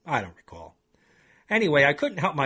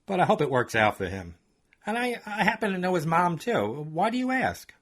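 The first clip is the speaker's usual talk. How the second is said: higher, chatty woman's voice; a pitched-up voice for Holly; Holly's voice; 5 semitones higher than usual. Acted. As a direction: gossip eagerly as the friendly coworker. Holly voiced through dialogue.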